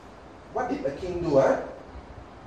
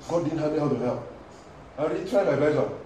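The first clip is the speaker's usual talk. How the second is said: cynical believer. Acted. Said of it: "Sounds like faith".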